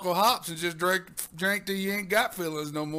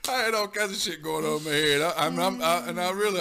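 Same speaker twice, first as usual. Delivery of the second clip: normal talking voice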